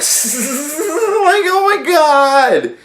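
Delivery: high, drawn-out "oh my God"; exasperated disbelief. Retold or imitated. Exasperated at an unbelievable result.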